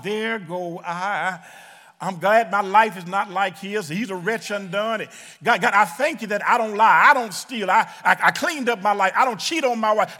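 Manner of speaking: proud deacon praying. Acted. Deacon at the altar thanks God he's not the drunkard, loud and proud. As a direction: boast to God about your own goodness. Deacon's boastful prayer in church.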